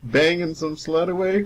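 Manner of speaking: ordinary delivery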